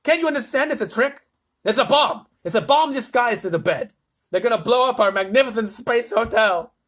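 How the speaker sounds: loud, high, lisping tirade; frantic lisping President; yelling. A narrator's reading. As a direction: rant in alarm at a dimwit. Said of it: "yelled the President".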